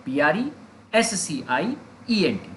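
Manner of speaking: "P R E, S C I, E N T" in three groups with pauses; spelled in chunks. Shown as a demonstration. Teacher helping learners remember the spelling, "P R E S C I E N T" broken into three parts. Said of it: "तीन हिस्सों में हमने इसे तोड़ दिया".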